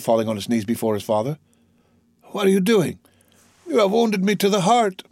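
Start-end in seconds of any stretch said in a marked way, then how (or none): 2.3-5.0 put-on high, distressed young man; anguished. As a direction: protest as if deeply hurt. Anguished exchange after son kneels.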